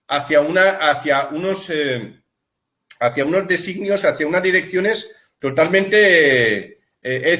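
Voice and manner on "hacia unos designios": ordinary talk, nothing marked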